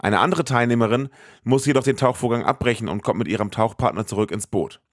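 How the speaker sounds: usual speaking voice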